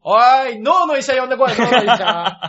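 laughing, exasperated shout; loud comic retort. Acted. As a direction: call out in mock alarm. Mock-outraged retort to bad impression.

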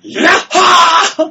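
突然の全力ヤッホーを再現; 溜めてから山に響くよう絶叫; 思いっきり叫ぶ真似. Retold or imitated. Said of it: "思いっきり叫ん". (思いっきり叫ぶヤッホー)

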